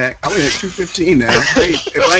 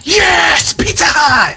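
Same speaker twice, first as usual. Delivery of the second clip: high excited yell; excited shout; 13 semitones higher, much slower than usual. Real, not acted. Excited cheer.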